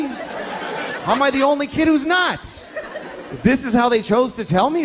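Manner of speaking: high, slow incredulous cry; mock disbelief. Kid at camp baffled by the others, high slow disbelief. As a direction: stretch it out in baffled panic.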